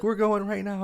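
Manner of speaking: rushing to the ER; panicked sister. Retold or imitated. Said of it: "She's like".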